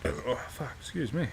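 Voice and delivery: talking through hiccups; hiccuping between words. Real, not acted. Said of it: "Got the hiccups".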